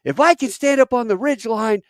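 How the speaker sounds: high, loud, commanding yell; lieutenant yelling at helicopter crews, commanding shout; shouting at pilots. Retold or imitated. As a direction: shout over the gunfire at the choppers. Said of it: "he goes".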